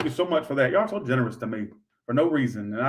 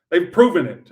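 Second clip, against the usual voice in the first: louder emphatic yell; 3 semitones higher, 7 dB louder than usual. Real, not acted. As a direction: hammer it at the viewer. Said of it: "Why am I yelling this?".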